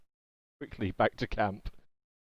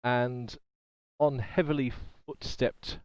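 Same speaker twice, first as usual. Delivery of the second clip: ordinary talk, nothing marked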